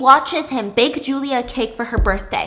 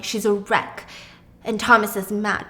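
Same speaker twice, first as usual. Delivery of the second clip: normal talking voice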